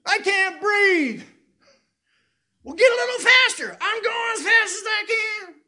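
high, loud, strained protest; a loud mock yell of frustration; exasperated outburst. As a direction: shout back like you can't breathe. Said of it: "I wanna just stop and say".

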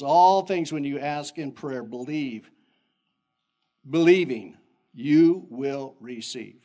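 slow, loud scripture; emphatic scripture reading. Acted. Preacher reading Matthew to the church, slow and emphatic. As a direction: read it out slowly, with weight.

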